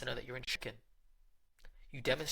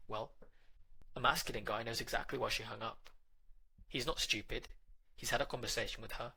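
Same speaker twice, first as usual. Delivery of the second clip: ordinary talk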